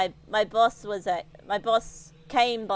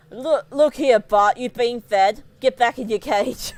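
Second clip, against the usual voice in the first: louder, slow mock telling-off of the snake; stern boss; 7 dB louder than usual. Boss scolding the snake Bart, a louder mock-stern order. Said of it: "and he's like".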